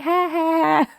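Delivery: high, flat mock ha ha; deadpan sarcastic laugh; fake laugh. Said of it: "And I was like".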